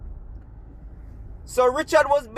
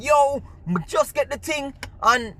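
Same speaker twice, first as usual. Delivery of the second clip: retold cursing out; 5 semitones higher than usual. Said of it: "I was cursing him out".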